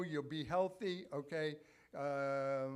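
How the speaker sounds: ordinary talk, nothing marked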